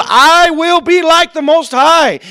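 high, slow, drawn-out declaration; proud boast. Acted. Preacher voices Lucifer's boast before his fall, grand and proud. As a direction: proclaim it with swelling arrogance.

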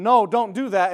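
loud firm parent's no; stern parent no. Retold or imitated. Parent scolding a child, loud and firm. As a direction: snap a firm no at a toddler. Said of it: "When you tell them".

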